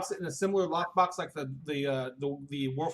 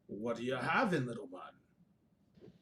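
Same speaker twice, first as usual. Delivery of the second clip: lightly voiced bartender, dropping low and slow at the end; a bartender sizing up a tiny customer; bartender looking down; 4 semitones lower, 5 dB quieter, slower than usual. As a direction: bartender to the small character, looking down on him. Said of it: "he's like, he looks down".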